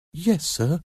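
put-on high, meek child's answer; reply obediently in a high boy's voice; frightened little boy. A narrator's reading. Boy answers the threatening man, meek and scared.

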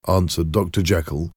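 usual speaking voice

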